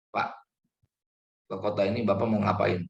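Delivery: a mild hint of a suspicious interrogator asking "ngapain". Shown as a demonstration. The wrong way to question a plane seatmate, mild interrogator. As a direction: question him like a light mock cop. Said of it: "bukan nada yang seperti orang interrogator".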